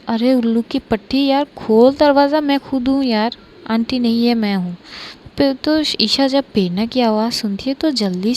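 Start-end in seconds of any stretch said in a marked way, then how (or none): none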